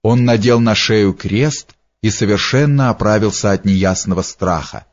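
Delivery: ordinary delivery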